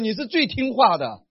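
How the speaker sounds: said loudly and high; loud declaration. Shown as a demonstration. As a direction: tell him firmly and loudly. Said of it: "你要大声对他说".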